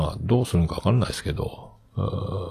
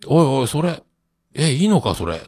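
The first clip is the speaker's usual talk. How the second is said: alarmed; 6 semitones higher than usual. Retold or imitated. Alarmed self in dream.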